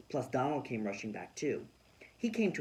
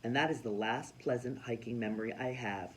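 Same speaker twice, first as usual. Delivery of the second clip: usual speaking voice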